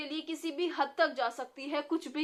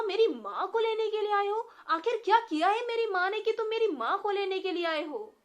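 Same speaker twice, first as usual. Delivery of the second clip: alarmed; 8 semitones higher, 7 dB louder than usual. A narrator's reading. Boy fearing for his mother.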